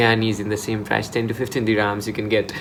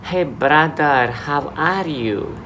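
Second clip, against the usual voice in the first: put-on higher, slow, sing-song Filipino-accented greeting; 4 semitones higher, slower than usual. Showing how Filipinos greet you in Dubai, Filipino accent. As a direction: draw it out high in a Filipino accent. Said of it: "Those Filipinos will come to say".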